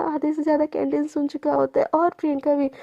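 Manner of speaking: usual speaking voice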